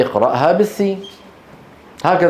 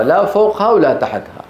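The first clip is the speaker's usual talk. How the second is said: ordinary delivery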